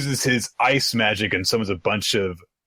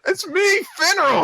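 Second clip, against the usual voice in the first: very high, whiny announcement; mock-exasperated high voice; pleading Finral. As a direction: plead to be recognized in falsetto.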